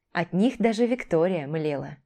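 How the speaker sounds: usual speaking voice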